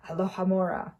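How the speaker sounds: usual speaking voice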